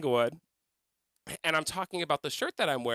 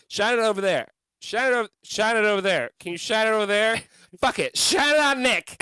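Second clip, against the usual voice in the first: louder, pointed directing; bossy searching; 5 semitones higher, 7 dB louder than usual. Retold or imitated. Trish telling her girlfriend where to shine light, bossy. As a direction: bark the orders at the flashlight holder.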